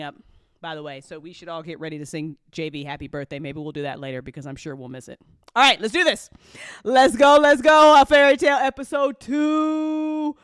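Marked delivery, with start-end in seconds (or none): 5.5-10.3 loud, building hype call; hype shout. Real, not acted. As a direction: pump up the crowd for the episode. Hyping up the episode start.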